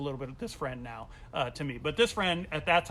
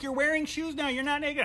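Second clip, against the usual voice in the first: crowd teasing; 10 semitones higher than usual. Retold or imitated.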